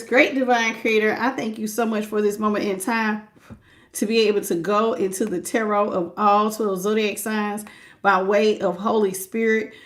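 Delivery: hushed invocation to spirit guides; prayer. Real, not acted. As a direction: pray quietly to open the reading. Opens the reading with prayer.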